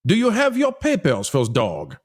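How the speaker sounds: put-on French accent. Official questioning kid with dog, French accent. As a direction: play a French official demanding papers. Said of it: "I did a French accent there".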